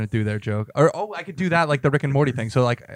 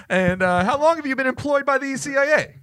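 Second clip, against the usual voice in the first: high mock interviewer; 13 semitones higher than usual. Interviewer grilling the puppet.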